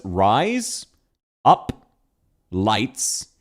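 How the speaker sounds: "rise", "up", "lights" one at a time; separate words. Shown as a demonstration. Streamer asking viewers to try the words, said one by one. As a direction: give each word alone, with a pause after it. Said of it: "say the words".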